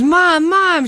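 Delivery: high calling shout of Mom; retold scream. Daughter calling mom from garage, excited call. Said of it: "she's screaming".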